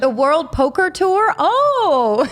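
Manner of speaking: a hint of American on "World Poker Tour"; light American accent. Host introducing herself to strangers in a light American accent. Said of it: "say it with a little bit of an American accent".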